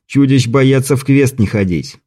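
usual speaking voice

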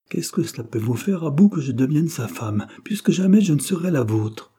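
defiant outburst. A narrator's reading. Girl standing up to the furious suitor, defiant outburst. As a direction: fling the refusal at him, pitch climbing. She defies his fury.

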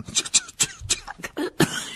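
coughing on a cloud of ash; choking cough. Acted. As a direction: sputter a cough. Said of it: "en toussant". (toux étouffée)

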